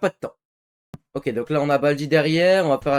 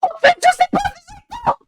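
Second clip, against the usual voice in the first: panicked squeak; 5 dB louder than usual. Real, not acted. Sudden panic, voice jumps.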